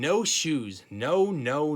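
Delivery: loud tantrum yell; toddler scream. A narrator's reading. Toddler kicking at the shoe salesman, loud yell. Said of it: "He screamed".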